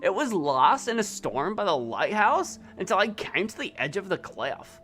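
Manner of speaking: lightly voiced Valley Girl reading. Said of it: "do the Valley Girl again".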